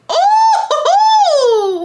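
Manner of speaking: high loud delighted squeal; excited squeal. Real, not acted. Reacting to the reveal with a high loud squeal. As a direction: shriek with glee at the news.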